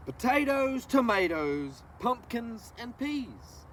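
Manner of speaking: slow, lilting read-aloud of a rhyming book; reading to children. Acted. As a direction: savor each word for the little ones. Reads picture book to children.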